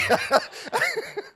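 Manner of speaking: high-pitched burst of laughter. Real, not acted. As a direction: laugh out at the memory. Laughing at son's story.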